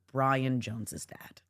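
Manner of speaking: letting the mistake land in a hush; quiet realization. Retold or imitated. Realizing she named the wrong dead man, quiet dread. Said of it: "It's a completely wrong death".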